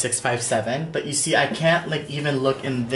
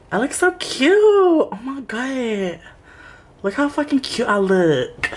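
high, drawn-out playful squeal; gushing self-admiration; 10 semitones higher than usual. Pretend. Vlogger admiring own reflection, high playful gushing. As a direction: coo over yourself, pitched way up.

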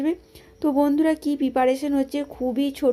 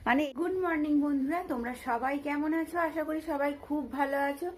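hoarse voice; 3 semitones higher, 8 dB quieter, faster than usual. Real, not acted. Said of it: "ঠান্ডা লেগে গলাটাও ধরেছে".

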